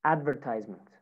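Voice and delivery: American "advertisement", "tise" rhyming with "size"; American accent. Shown as a demonstration. Teacher models American "advertisement" for students, long I in "tise". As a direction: say "advertisement" as American "AD-ver-tize-ment". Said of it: "American accent".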